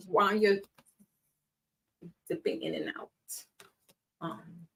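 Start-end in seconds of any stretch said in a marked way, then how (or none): 2.3-3.1 whisper. Real, not acted. Hushed warning aside.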